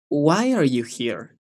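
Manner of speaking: "Why are you here?" slow and smooth, all words joined; soft, linked question. Shown as a demonstration. Teacher shows how to join it all, softly linked. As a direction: gently run "Why are you here?" together, rising on WHY then falling. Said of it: "intenten unirlo a todo, pero suave".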